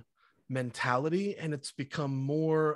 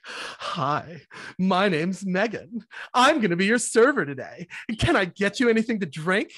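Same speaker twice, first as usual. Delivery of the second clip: higher, louder, over-bright waitress greeting; fake server voice; 6 semitones higher, 6 dB louder than usual. Customer mocks a server's canned greeting, higher and louder. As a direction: put on a scripted restaurant server act. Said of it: "and they're like".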